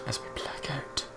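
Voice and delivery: soft unvoiced echo of the line; echoing whisper.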